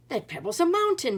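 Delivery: loud astonished exclaim; giant exclaiming. A narrator's reading. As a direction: protest at the size of it. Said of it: "he said".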